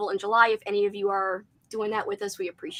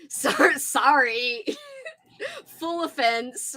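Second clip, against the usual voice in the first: laughing; 4 semitones higher, much slower than usual. Real, not acted. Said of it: "You have to read it in a robot voice".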